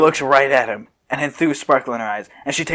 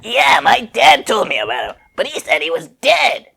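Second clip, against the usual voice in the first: put-on higher, lighter kid boy voice; young Trunks piping up; 9 semitones higher, 4 dB louder than usual. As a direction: play him as a young boy. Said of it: "if I had recognized it was a kid".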